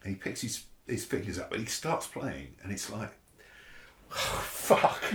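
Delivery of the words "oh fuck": breathless whispered oh fuck; stunned, words breathed out with no voice; awed whisper. Retold or imitated. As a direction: whisper it in sheer awe. Awed reaction as Herbie starts.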